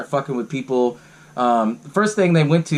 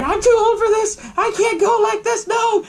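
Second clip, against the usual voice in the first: put-on very high old-woman wail; mock old lady. Retelling Missus Deagle in her chairlift, high mock wail. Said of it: "she gets in the chair".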